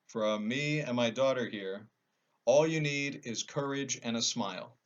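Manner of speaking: ordinary delivery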